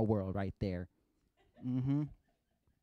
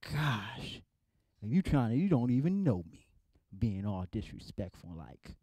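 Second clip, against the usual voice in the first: normal talking voice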